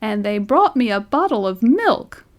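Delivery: a hint of an old man's shrill protest; mildly voiced old man's indignant complaint. A narrator's reading. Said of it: "his voice rose to a shrill note of protest".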